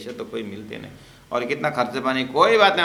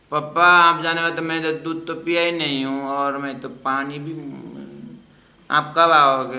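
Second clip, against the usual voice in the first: put-on pleading child; 4 semitones higher, 6 dB louder than usual. Grandson pleading on phone.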